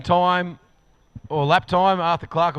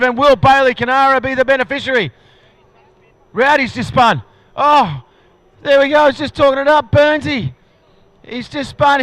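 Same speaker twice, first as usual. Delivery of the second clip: excited race call; 4 semitones higher, 3 dB louder than usual. Real, not acted. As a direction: pitch climbing higher and louder as the battle builds. Race finish, driver spins out.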